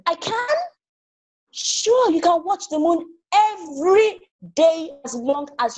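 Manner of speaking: high, bright eager squeal; delighted little sister. Acted. Little sister thrilled she can watch the moon. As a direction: lift way up with joy.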